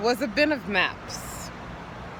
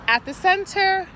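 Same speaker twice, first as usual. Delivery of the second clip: normal talking voice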